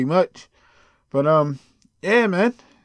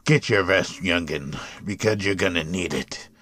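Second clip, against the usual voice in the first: low, softer elder's counsel; wise old man; 10 semitones lower, 4 dB quieter than usual. Acted. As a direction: advise the youngster in a deep old man's voice. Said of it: "Just an old man to you".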